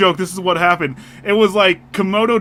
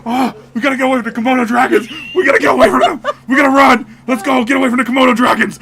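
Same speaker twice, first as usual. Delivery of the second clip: loud, fast, higher panicked yelling; frantic mock shout; 6 semitones higher, 8 dB louder, faster than usual. Husband retelling dream, yelling at wife to run. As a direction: yell to escape the dragons. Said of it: "and I'm all".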